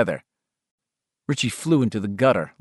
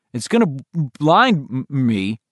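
stuttering; 3 semitones higher than usual. Acted. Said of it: "Bill said".

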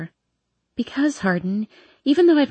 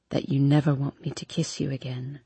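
low seductive voice; 7 semitones lower, 7 dB quieter than usual. A narrator's reading. Hardin's voice, low and soft.

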